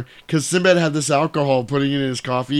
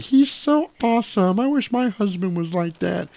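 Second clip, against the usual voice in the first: swooning wives; 8 semitones higher than usual. Acted. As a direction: gush like adoring neighborhood wives, way up high. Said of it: "all the wives are like".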